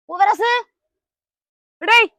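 shouting for the friend who hid inside; calling out. Acted. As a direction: call his name across the yard. Calling the friend at locked house.

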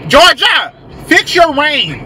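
loud, high call to the sky; playful shout. Real, not acted. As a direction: yell it up at the rain. Calling out at the rain.

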